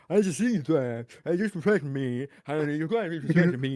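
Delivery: loud garbled put-on voice; Eric July impression. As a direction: ham up a muddled speech. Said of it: "Eric July is like".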